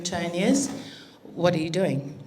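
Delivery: low, lightly suspicious question; casual probing. Retold or imitated. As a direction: ask it low, as if only mildly curious. Mother questioning son over washing.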